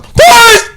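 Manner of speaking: very high loud yelp of a word; karate yell. Retold or imitated. Showing off his breaking sound to the class, piercing yell. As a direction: scream police as a kiai. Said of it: "I showed".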